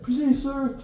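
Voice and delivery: high, loud answer to the MTI; yelled reply. Retold or imitated. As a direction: shout it back as you run. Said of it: "what you're yelling".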